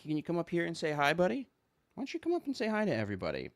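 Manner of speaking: high, soft and quick. Real, not acted. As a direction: coax the cat sweetly. Talking to cat.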